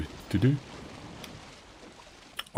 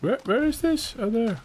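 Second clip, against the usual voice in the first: surprised. Real, not acted. Searching the map, startled.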